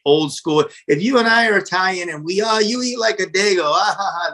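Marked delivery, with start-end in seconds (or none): 2.4-4.3 higher-pitched playful tease with a mock laugh; friendly jab, lightly acted; joking ribbing. Shown as a demonstration. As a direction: rib a fellow Italian in fun. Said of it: "it's kinda funny".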